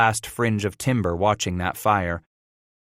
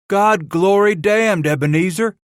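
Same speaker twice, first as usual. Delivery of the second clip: astonished exclamation; 11 semitones higher, 6 dB louder than usual. Acted. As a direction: burst out in amazement to Ebenezer. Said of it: "the last of the men exclaimed".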